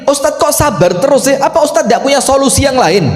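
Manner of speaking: questioning congregant. Retold or imitated. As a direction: push back on the advice.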